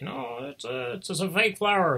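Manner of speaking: a hint of a smug parrot blowing the trick; cheeky parrot. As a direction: parrot heckling the magician, mildly squawky. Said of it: "the parrot's like".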